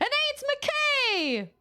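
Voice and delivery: very high squeaky character voice; requested voice. Pretend. Doing the voice a viewer requested, very high. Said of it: "someone asked me to talk like that".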